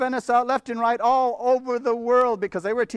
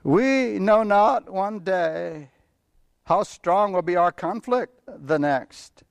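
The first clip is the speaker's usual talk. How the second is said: 1 scripture reading; 5 semitones lower than usual. Acted. Reads passage aloud.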